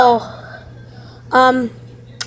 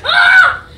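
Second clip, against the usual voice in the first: high pretend scream; 11 semitones higher than usual. Screams at toy gun.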